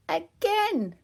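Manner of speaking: put-on high little-girl voice asking; high piglet voice pleading; toddler Peppa. A narrator's reading. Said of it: "said Peppa".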